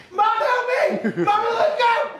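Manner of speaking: put-on high, loud panicked kid cry; high kid voice pleading for help; kid yelling for mom. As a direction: shriek for mom like a bullied kid.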